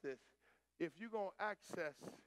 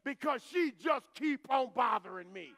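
exasperated judge; 4 semitones higher, 7 dB louder than usual. Retold or imitated. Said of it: "exasperated judge".

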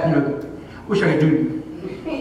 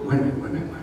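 low, hushed stalling; hesitant backpedalling; 7 semitones lower, 8 dB quieter, much faster than usual. Retold or imitated. Man stalling the Lord's question, hushed and hesitant. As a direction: drop to a soft, low murmur.

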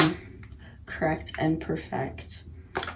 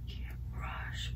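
whispering; 14 dB quieter than usual. Real, not acted. Whispering during makeup.

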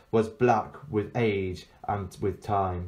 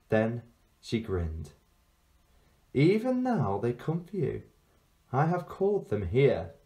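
put-on sweet child with an old witch beneath; sinister sweet girl; 5 semitones higher than usual. Witch-girl speaks in story.

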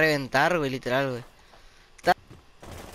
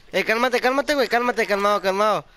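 high, louder pleading calm down; excited pleading; 5 semitones higher, 4 dB louder than usual. Real, not acted. Gamer pleading with his teammate to calm down, high and urgent. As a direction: beg him to calm down, worked up.

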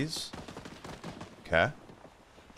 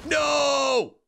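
high, loud no; dismayed shout; 9 semitones higher, 4 dB louder than usual. Real, not acted. Gamer shouting in dismay mid-fight. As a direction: yell it out.

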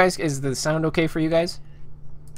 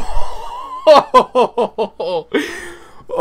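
high nervous burst of laughter; shocked laugh; 13 semitones higher, 4 dB louder than usual. Real, not acted. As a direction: laugh out the fright. After jump scare.

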